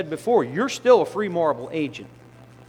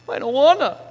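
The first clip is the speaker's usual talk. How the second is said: put-on high little-kid whine; childish whine; 9 semitones higher, 7 dB louder than usual. Sinner refusing God like a child, high and whiny. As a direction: pout it like a child.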